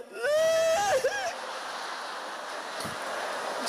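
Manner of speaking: long, very high shouted laugh; a squealing hoot held high; high shrieking laugh. Acted. Crowd reacts to mock crying.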